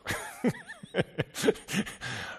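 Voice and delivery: a short bursting laugh, pitched high; high giggle. Acted. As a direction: giggle as if caught out. Acting caught-out listener. (verlegenes Kichern, hoch)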